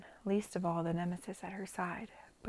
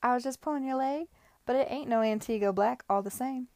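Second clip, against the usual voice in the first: light Caribbean patois, higher; broad patois; 4 semitones higher, 5 dB louder than usual. Acted. Miss Lamb teasing Lady Denham in a light Caribbean patois. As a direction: tease in a broad West Indian patois. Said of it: "she reverted to the patois again".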